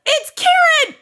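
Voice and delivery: high, loud re-enacted outcry; mock-horrified cry. Owner re-enacting finding her chewed Kieran toy, high alarmed shout.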